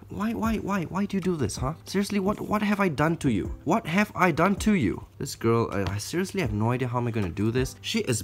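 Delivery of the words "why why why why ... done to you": high, whiny appeal to a pet; mildly hurt, higher-pitched complaint; plaintive pleading. Real, not acted. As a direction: plead as if wronged. Pleads with tarantula as if wronged.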